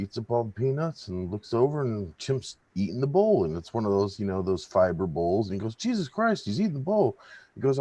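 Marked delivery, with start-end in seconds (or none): 5.8-7.2 much higher, startled outburst; shocked bartender. Acted. Bartender shocked the chimp eats the bowl. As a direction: jump up in alarm.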